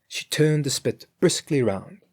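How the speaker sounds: ordinary delivery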